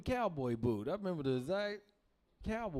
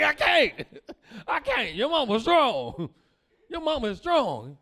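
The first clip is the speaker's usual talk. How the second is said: high, loud, laughing through the words; laughing dad; 7 semitones higher, 8 dB louder, slower than usual. Retold or imitated. Dad on the floor laughing, high and loud. Dad voiced after being slammed.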